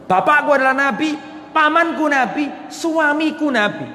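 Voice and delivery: acted triumphant boast; proud retort. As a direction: list your lineage to silence them. Acting Sofia's proud retort.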